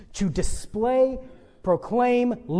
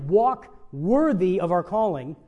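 normal talking voice

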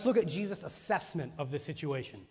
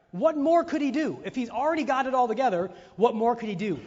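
higher, louder repeat of the question; rising emphatic preaching; 6 semitones higher, 6 dB louder than usual. Real, not acted. Preacher to his church, repeating the question higher and louder. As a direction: press the question home to the church. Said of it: "preacher repeats louder, higher".

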